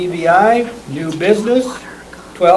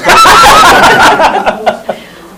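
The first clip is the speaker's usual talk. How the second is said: loud laugh; 9 dB louder than usual. Real, not acted. Laughs at own joke.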